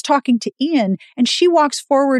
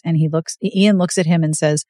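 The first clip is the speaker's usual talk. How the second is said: normal talking voice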